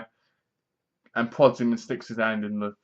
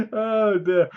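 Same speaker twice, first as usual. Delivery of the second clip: high, real laughter through the words; helplessly amused, laughing while speaking; cracking up; 8 semitones higher than usual. Real, not acted. Narrator cracks up at own retelling, laughing. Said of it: "I cracked myself up".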